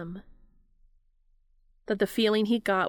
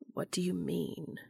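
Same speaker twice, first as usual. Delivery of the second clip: puzzled; 6 dB quieter than usual. A narrator's reading. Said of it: "Cass asked, puzzled".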